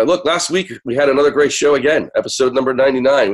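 normal talking voice

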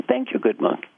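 high-pitched, grateful little voice; mock-delighted thanks, pitched high; lightly acted happy gratitude. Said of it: "She's so happy".

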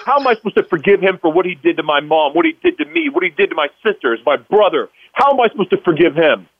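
high, fast, outraged questioning; retold indignant protest. Son protesting to God about his father, retold indignation. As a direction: argue back in disbelief, re-enacting it. Acted argument back at God.

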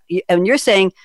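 ordinary talk, nothing marked

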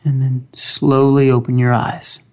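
slow, calm guided meditation voice; grounding exercise. Acted. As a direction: guide them gently into stillness, long pauses.

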